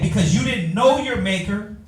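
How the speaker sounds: ordinary talk, nothing marked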